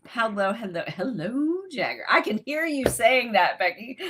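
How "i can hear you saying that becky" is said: imitating Becky. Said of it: "She wouldn't say it like that".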